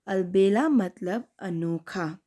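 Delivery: ordinary talk, nothing marked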